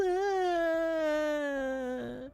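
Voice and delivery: put-on high sobbing little girl; girl's sob. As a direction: sob like a small child.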